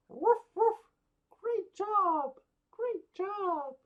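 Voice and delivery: very high, loud cartoon dog voice; squeaky dog puppet praising kids. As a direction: yap like a tiny puppy.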